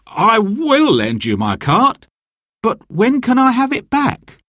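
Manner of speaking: agree, but anxiously; worried butcher. Acted. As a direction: butcher lending his cart, still uneasy.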